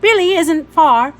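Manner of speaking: high, loud and fast; brisk reassurance. A narrator's reading. Woman driving, tossing a quick promise back to the children, fast and high. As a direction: rattle it off over your shoulder. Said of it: "she said, looking back at us".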